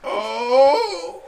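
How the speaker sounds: high, slow cartoon lament; mock wailing dad. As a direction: wail it, close to cracking up. Acted dad wailing at the news.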